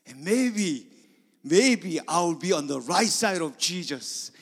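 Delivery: loud, high, impassioned sermon; full-force preaching; fiery preaching. Acted. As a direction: build up to a climax.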